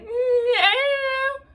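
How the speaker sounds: very high, loud, stretched-out mock squeal; awkward dodge. Answering a fiancé's wish question, awkward squeal. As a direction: imitate your awkward self squealing. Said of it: "an awkward human being".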